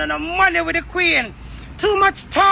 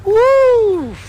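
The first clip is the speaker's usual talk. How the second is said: loud high whoop; 8 semitones higher, 5 dB louder than usual. Real, not acted. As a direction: let out a loud celebratory whoop. Celebrating herself.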